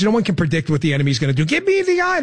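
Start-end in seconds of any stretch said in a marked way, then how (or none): 1.4-2.2 mock witch. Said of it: "You're not the Stygian witches".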